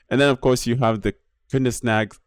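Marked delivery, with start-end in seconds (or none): 1.5-2.2 "Koenigsegg" botched as KIN-us-nags; stumbled pronunciation. Real, not acted. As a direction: trip over "Koenigsegg", saying KIN-us-nags. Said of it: "cannot say that name".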